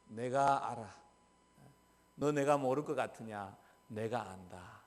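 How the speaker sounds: soft, low voice of God comforting; God reassuring. Retold or imitated. God speaking to a wronged believer, soft and low. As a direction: quietly assure them you know.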